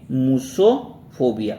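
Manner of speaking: drawn out slowly, syllable by syllable; slow teaching pronunciation. Shown as a demonstration. Teacher to students, dictating the word slowly. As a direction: say it slowly so students can copy it. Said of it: "क्या बोल दोगे?".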